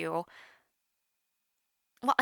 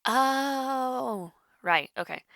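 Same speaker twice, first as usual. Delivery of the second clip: usual speaking voice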